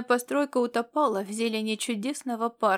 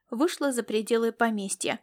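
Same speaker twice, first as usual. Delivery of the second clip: plain reading voice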